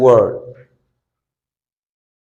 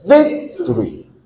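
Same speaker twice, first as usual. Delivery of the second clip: "Victory" with VIC held high and long; stretched, raised first syllable in "Victory"; stress on first syllable; 8 semitones higher than usual. Shown as a demonstration. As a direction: hit VIC in "Victory", then drop. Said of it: "this one is still stressed".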